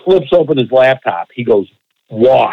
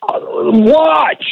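exasperated; 11 semitones higher than usual. Retold or imitated. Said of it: "he gives me this exasperated sigh".

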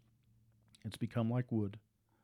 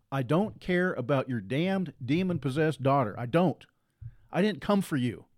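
raised, emphatic pulpit delivery; full-force preaching; 5 semitones higher, 4 dB louder than usual. Acted. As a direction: drive each line home to the congregation.

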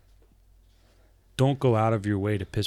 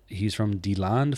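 ordinary talk, nothing marked

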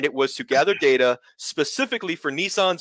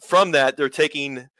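ordinary talk, nothing marked

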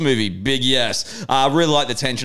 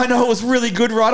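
put-on humouring girlfriend, pitched way up; high mock girlfriend voice; 10 semitones higher than usual. Girlfriend humouring him, high mock voice. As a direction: agree high and indulgent. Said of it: "She's like".

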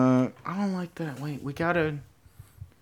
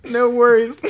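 high, loud, through laughter; laughing hard as you say it; 11 semitones higher, 7 dB louder than usual. Real, not acted. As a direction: crack up while repeating the line. Laughing at the line just written.

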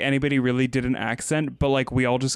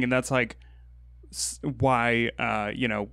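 usual speaking voice

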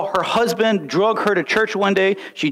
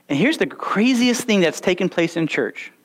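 ordinary talk, nothing marked

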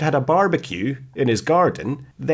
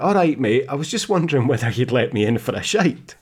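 usual speaking voice

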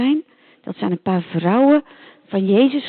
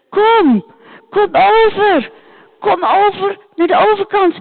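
high, loud calling out from far; calling across the sea; 8 semitones higher, 4 dB louder than usual. Acted. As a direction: call him over, pleading. Man calls across the sea. (hoog en luid roepend over de zee)

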